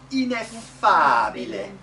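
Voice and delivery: loud, slow "Ineffabile"; model Italian pronunciation. Shown as a demonstration. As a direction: stretch "fa" in "Ineffabile" with full Italian vowels. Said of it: "they have good vowels".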